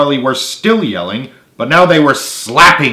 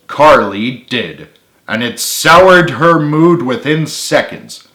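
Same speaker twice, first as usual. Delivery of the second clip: usual speaking voice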